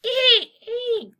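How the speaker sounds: high, loud bird shrieks; jay screech. Acted. Reader correcting the jay's sound, screeching. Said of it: "No, they were more like".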